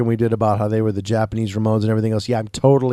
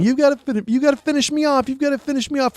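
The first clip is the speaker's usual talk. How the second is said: mock yell; faster than usual. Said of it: "he's yelling".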